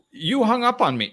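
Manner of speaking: shrill-free mock outrage, loud and high; angry caller. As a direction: play the outraged caller who got hung up on.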